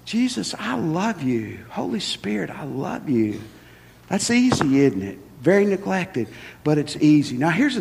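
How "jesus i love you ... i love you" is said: softer and higher, intimate praise; soft prayer. Shown as a demonstration. Preacher models simple praise for the listeners, soft and intimate. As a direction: whisper it lovingly to God. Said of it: "But just tell Him".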